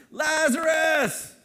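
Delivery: long, high, loud drawn-out call of the name. Acted. Preacher voicing Jesus calling Lazarus from the tomb, loud drawn-out call. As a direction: call him out of the tomb at full voice. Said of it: "He cries out in a loud voice".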